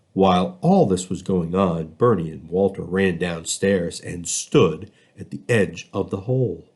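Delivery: plain reading voice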